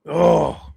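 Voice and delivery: loud, high exclaimed "oh"; worked-up oh. Real, not acted. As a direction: blow off excitement in one loud oh. Worked up after the stop.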